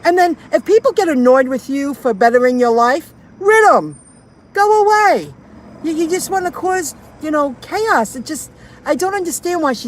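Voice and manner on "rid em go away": higher, louder brush-off; dismissive outburst. Real, not acted. Driver venting at annoying people, loud dismissive outburst. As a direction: wave them away for good.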